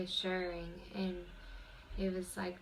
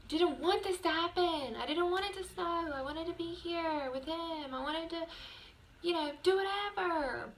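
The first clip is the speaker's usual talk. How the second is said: frustrated whine; 10 semitones higher than usual. Retold or imitated. Venting to viewers about leaving her boyfriend, frustrated high whine. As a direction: whine like a kid denied her way. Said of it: "I was so frustrated".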